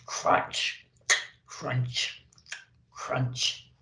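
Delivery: soft whispered crunch crunch crunch; crunching sound. Acted. Reindeer crunching his carrot, soft and whispered. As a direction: mimic a reindeer chewing carrot.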